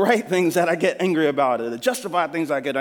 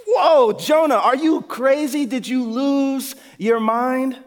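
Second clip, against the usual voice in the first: higher and louder, slow: whoa, are you crazy; shocked disbelief at Jonah's request; stretched-out amazed outburst; incredulous exclamation; 7 semitones higher, 4 dB louder, slower than usual. Real, not acted.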